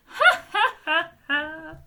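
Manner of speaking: loud high burst of laughter; helpless laughter. A narrator's reading. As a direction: break into uncontrollable laughing. Bungo collapses in laughter.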